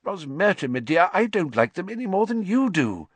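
high, loud, defensive protest; flustered official defending himself; uncomfortable. A narrator's reading. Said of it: "said Fudge uncomfortably".

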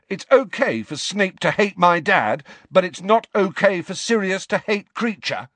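high, heated yell of protest; angry yell. A narrator's reading. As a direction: throw the double standard at him, high and indignant. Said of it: "yelled Harry".

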